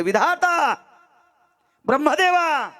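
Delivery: high, drawn-out invocation; calling out. Acted. Saint calling up to Brahma for answers, calling out. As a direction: hail the gods far above you.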